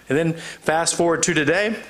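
usual speaking voice

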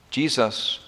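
ordinary talk

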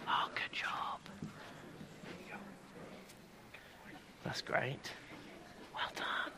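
whispered praise; whispering. Real, not acted. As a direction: whisper it gently. Praising kids during quiet activity.

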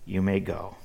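father quietly granting permission; soft reply. Retold or imitated. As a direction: give in softly like a father. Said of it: "replied softly".

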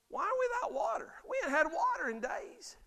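a high mock whine about no water; whiny complaining Israelites. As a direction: whine it like a thirsty grumbler. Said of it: "they're like".

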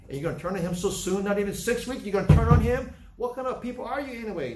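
rising to a loud, high rebuke at a faithless crowd; indignant rebuke. Retold or imitated. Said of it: "is what he's saying".

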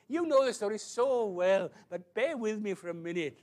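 loud, high, eager appeal; animated pulpit plea at full voice; excited preaching. Real, not acted. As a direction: urge the congregation along with gusto.

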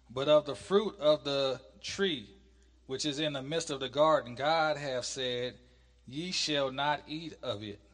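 loud, measured Bible reading; scripture reading. Acted. Teacher reads God's command from Genesis to class, scripture reading. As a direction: read it out slowly, with weighty stresses.

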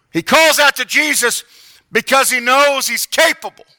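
loud, high, impassioned sermon; full-force preaching. Acted. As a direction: preach it with fire.

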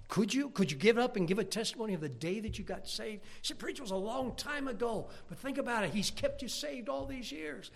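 loud, high, building preacher's call; full-force preaching. Acted. Preacher pressing the congregation about salvation, loud and high.

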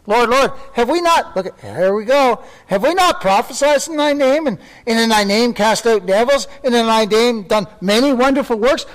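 high, loud mock pleading; boastful false believers. Preacher voicing false believers boasting to the Lord. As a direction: play the smug professors crying Lord, Lord. Mocking religious boasters quoted.

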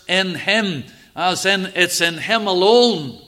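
loud, lifted preaching voice; preaching at full force. Acted. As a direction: proclaim it to the congregation.

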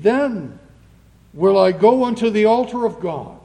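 ringing pulpit reading, phrase by phrase; scripture reading. Acted. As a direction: proclaim the verse to the congregation. Reads psalm aloud in sermon.

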